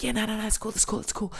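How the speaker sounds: high, hushed, hurried reassurance; imitated high performers. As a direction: rattle it off breathily in a mock-high voice. Retold high performers eagerly reassuring him.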